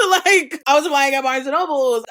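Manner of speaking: a real laugh spilling into the words, high and loud. Real, not acted. Telling viewers she applied at Barnes and Nobles, laughing. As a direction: laugh it off, incredulous. Laughing at her own job hunt.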